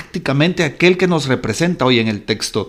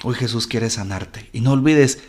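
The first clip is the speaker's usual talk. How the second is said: ordinary delivery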